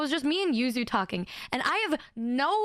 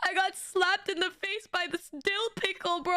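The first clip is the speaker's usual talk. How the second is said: laughing; 5 semitones higher than usual. Real, not acted.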